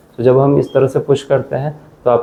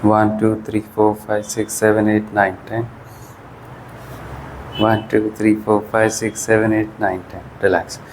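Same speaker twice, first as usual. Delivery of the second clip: exercise count; 2 semitones lower, 5 dB quieter than usual. Shown as a demonstration. Physio counting viewers through an isometric wall push, steady count. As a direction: count the hold out evenly, like a physio.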